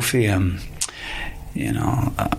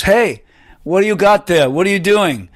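loud, higher retold call from far back; 9 semitones higher, 4 dB louder than usual. Henrik calling from the back of the bus, loud retold shout.

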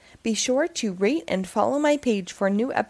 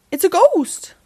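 louder, higher alarmed cry; frightened cry; 8 semitones higher, 6 dB louder than usual. Acted. A cow spots the sheet ghost, frightened cry. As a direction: scream out in fright.

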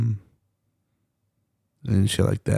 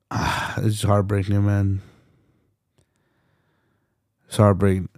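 heartbroken. Real, not acted. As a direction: sound truly heartbroken. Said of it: "look how I sound".

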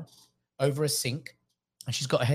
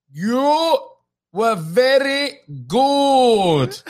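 very slow, loud, high royal voice; King Charles; 12 semitones higher, 12 dB louder, much slower than usual. Retold or imitated. Then-Prince praising a supposedly foreign comic, slow loud royal voice. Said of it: "because I hadn't changed my ac-, uh, accent".

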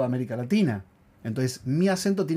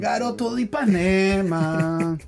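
drawn-out, higher mock-Brazilian lilt; playful Brazilian accent; 4 semitones higher, much slower than usual. Echoing the co-host's nickname for him, hammed-up Brazilian accent.

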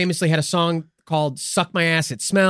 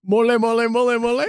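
Austin Powers quote; 3 semitones higher than usual. Retold or imitated. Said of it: "From Austin Powers?".

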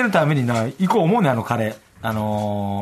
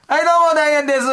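loud, high stage greeting; manzai stage greeting; 12 semitones higher, 4 dB louder, faster than usual. Retold or imitated. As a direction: open the act like onstage. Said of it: "って".

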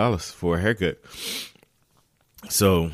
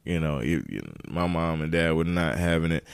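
normal talking voice

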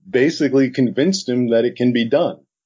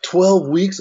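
incredulous; 7 semitones higher than usual. Retold or imitated. Retold shock at deadline.

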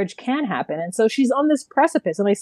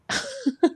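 high laugh; 5 dB quieter than usual. Real, not acted. Laughs at own retort.

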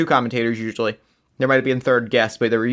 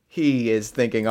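ordinary talk, nothing marked

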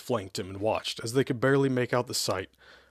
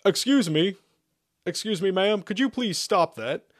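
high-pitched royal negotiator calling out; shouted polite plea; 9 semitones higher, 4 dB louder than usual. A narrator's reading. Negotiator calls from castle top to attacker, shouting. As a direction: call up high, asking politely. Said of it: "the royal negotiator shouted".

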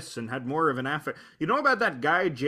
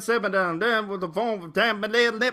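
a lightly voiced Italian singer faking English, a touch higher; fake English gibberish; 5 semitones higher than usual. A friend imitates the Italian song that sounds like English, in mock fake English. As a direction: mumble English-sounding nonsense words. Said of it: "supposed to sound like English".

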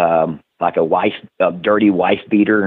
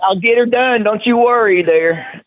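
much higher, slow exaggerated Southern drawl; hammed-up Southern accent; 12 semitones higher than usual. Guest mocks his own Southern accent, slow and much higher. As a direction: lay the Southern twang on thick for a laugh.

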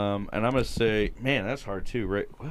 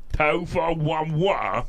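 slow, higher British parody; mock British accent; 4 semitones higher, much slower than usual. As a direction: stretch out a broad mock-British line. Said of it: "How do they say it?".

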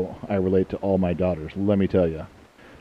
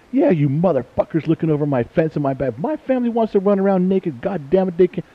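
higher-pitched, heated retelling of an outburst at the neighbours; retold rant; 9 semitones higher than usual. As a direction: rant at the peeping neighbours, half-acted. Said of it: "I'm like".

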